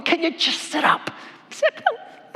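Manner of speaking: bossy command. Acted. Wife ordering her slouching husband to sit up, bossy and high. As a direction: snap at him to straighten up.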